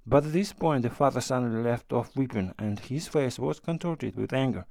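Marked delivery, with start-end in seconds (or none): none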